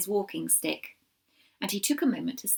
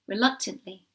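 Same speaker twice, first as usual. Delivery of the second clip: plain reading voice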